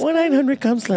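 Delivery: high sultry hotline ad voice; sexy hotline voice. Pretend. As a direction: tease it like a late-night phone line. Mock phone sex line ad.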